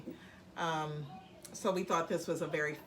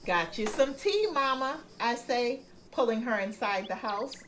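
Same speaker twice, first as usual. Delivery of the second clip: story narration; 5 semitones higher, 4 dB louder than usual. A narrator's reading. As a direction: read it unhurried for a child. Parent reads the story.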